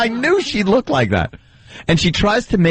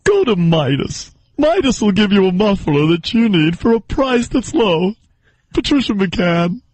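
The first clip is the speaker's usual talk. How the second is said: radio commercial read, pitched well above own voice; Patricia McCann. Acted. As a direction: parody an ad reader dropping in her name. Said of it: "you ever listen to commercials where it's like".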